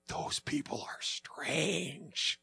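very slow, hushed judgment; wary aside. Retold or imitated. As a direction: imitate an eavesdropper quietly weirded out. Acting eavesdropper judging them at restaurant.

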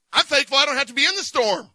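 ordinary delivery

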